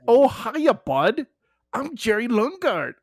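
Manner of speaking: high, loud, exaggerated UP accent; Upper Peninsula accent. Shown as a demonstration. Host demonstrating the accent for his co-host, broad UP accent. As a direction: commit hard to a sing-song Upper Peninsula lilt. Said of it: "yeah, how about this?".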